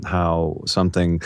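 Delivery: ordinary talk, nothing marked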